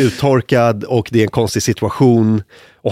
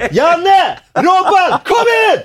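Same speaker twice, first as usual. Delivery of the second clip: high, louder shout of names; hollering colleagues into the bathroom; laughing cop yelling for his mates, high and loud; mock shouted call; 3 dB louder, much slower than usual.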